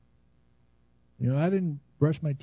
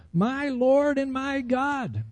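high, very slow proclamation; emphatic, stretched-out retold cry of faith; emphatic declaration; 8 semitones higher, much slower than usual. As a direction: draw out each word of Thomas's confession, pitched up. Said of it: "He emphatically says".

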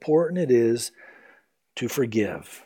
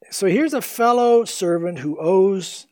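ordinary talk

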